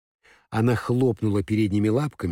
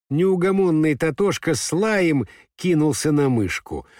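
ordinary delivery

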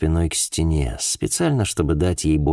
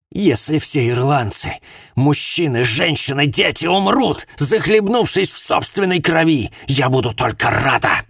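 rising, louder hateful outburst; building rage; 9 semitones higher, 3 dB louder than usual. Acted. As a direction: stoke the anger line by line. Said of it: "как будто разжигая свой гнев".

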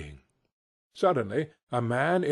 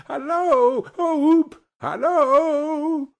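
high, loud, long hailing calls; excited far-carrying shouts, pitch way up; hallooing; 7 dB louder, much slower than usual. Acted. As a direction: whoop and holler after someone running away. Scrooge hails running Friday.

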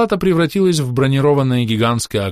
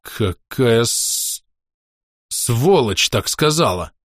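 slow, rasping outrage; wheezed out; much slower than usual. A narrator's reading. Father hearing his daughter was insulted, hoarse wheeze. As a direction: croak it out through a tight throat. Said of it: "прохрипел я".

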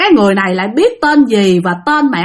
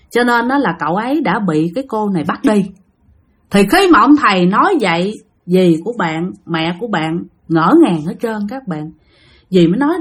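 usual speaking voice

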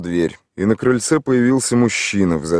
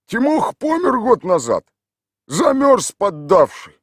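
high, put-on village voice; gruff local man answering a stranger; rough villager; 14 semitones higher, 4 dB louder, slower than usual. A narrator's reading. Tolik answers the city stranger in a rough village voice.

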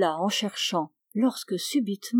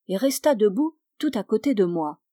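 ordinary delivery, nothing marked

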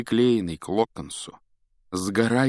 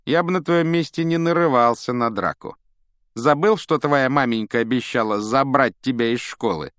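raised, loud, jeering tone; mocking taunt; 5 semitones higher, 6 dB louder than usual. A narrator's reading. Malfoy reminds Ron of his mother's threat, taunting. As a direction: needle him with a false warning. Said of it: "предупредил насмешливо Малфой".

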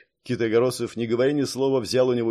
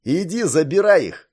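raised, higher angry order; angry call through a door; 6 semitones higher, 6 dB louder than usual. A narrator's reading. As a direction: throw it out loud and angrily. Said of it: "Голос злой".

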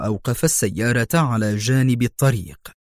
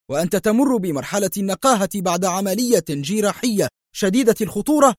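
high-pitched, rapid angry scolding; sharp rebuke; 9 semitones higher than usual. A narrator's reading. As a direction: fire accusing questions at the patient. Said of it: "قائلا في حدة".

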